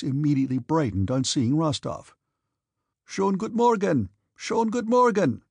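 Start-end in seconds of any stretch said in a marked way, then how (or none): 3.1-5.4 high, sing-out German greeting; merry greeting. A narrator's reading. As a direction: greet heartily in German, pitch lifted. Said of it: "winking with a merry smile".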